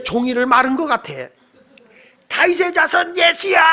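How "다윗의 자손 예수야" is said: desperate shout. Retold or imitated. Blind beggar crying out to passing Jesus, desperate shout. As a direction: call out to him with everything. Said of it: "소리를 지릅니다".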